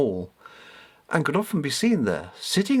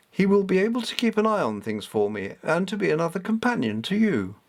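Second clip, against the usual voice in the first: high, stately, commanding tone; commanding goddess; 5 semitones higher than usual. Acted. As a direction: lay down the law like a goddess. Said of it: "the commanding tone".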